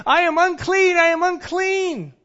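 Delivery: high, loud cry of unclean; teaching kids the leper's cry; leper's shout. Retold or imitated. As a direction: show the kids how lepers called out. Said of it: "You have to shout out".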